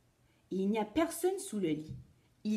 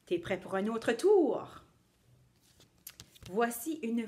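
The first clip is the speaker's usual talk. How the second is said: put-on cheerful children's show host; kids party magician; 3 semitones higher than usual. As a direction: play the magician for little kids.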